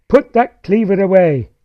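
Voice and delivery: affronted mum laying down the law; indignant mother. A narrator's reading. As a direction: take umbrage and order him off loudly. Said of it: "taking umbrage".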